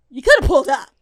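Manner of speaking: loud playful reproach; mock outburst. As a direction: shout it out in mock outrage. Playful outburst at absent LeBron.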